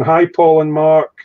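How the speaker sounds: drawn-out friendly hello; imitating a cheery greeting. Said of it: "Frankie's going".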